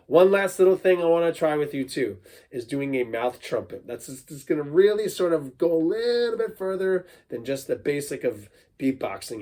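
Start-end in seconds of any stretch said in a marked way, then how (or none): none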